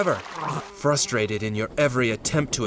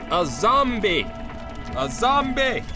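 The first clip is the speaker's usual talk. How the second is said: high, melodramatic cry of horror; horrified reveal; 10 semitones higher than usual. Acted. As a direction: cry it out higher the second time.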